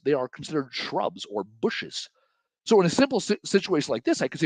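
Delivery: normal talking voice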